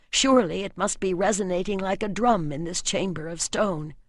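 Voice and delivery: ordinary delivery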